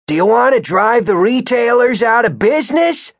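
put-on high-pitched, indignant old man; loud challenge. A narrator's reading. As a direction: confront him, voice pitched right up. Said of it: "he said loudly to Skip".

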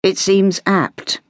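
slow, considered verdict; measured, musing gentleman; slowly. Acted. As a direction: draw it out thoughtfully. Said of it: "he said slowly".

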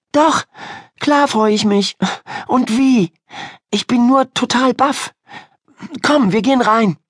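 put-on high, lively girl's voice; flustered, glad girl. Ida assuring Miriam that she is glad, eager. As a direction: reassure your friend brightly, pitched high. Ida reassures her friend.

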